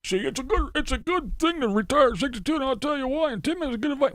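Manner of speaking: high mocking know-it-all voice; mocking commenters. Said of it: "people come over here and go".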